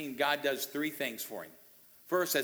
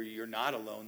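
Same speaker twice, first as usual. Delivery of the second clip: ordinary delivery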